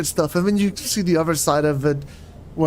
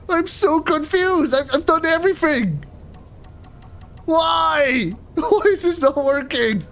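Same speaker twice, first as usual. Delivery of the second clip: higher, puzzled and frustrated; exasperated confusion; 10 semitones higher than usual. Real, not acted. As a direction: plead why it won't work. Keybind not working.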